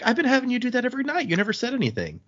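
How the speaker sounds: high, fast, incredulous retelling; acted astonishment, pitch shooting up; startled reproach. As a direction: blurt it out in dismay. Quoted startled reproach about hunger.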